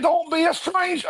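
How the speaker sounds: squeaky high put-on voice, mocking the line; mock Paul falsetto. Reviewer mocking the movie dad's farewell, in a squeaky falsetto. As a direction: sing it out way up high like a silly dad. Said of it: "Paul said".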